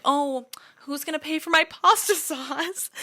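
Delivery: high put-on whiny voice with a laugh; mock whining. Teasing roommate over who pays for the sauce, whiny. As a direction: whine it, half laughing. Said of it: "I kind of kept saying".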